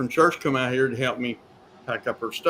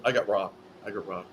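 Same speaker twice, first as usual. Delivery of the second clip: inner thought; 6 semitones lower, 8 dB quieter, faster than usual. Retold or imitated. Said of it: "So I thought".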